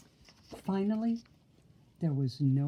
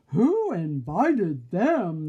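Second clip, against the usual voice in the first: huffy; 7 dB louder, much slower than usual. A narrator's reading. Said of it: "the wolves huffed".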